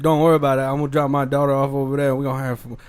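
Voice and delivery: a loud Cajun sheriff voice; Cajun accent. Retold or imitated. Podcast host voicing the sheriff's Cajun reassurance, loud Cajun accent. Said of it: "it was a Cajun accent".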